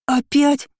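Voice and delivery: put-on high, girlish dismayed outburst; blurted out. A narrator's reading. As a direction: blurt it like young sisters appalled. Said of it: "хором выпалили близняшки".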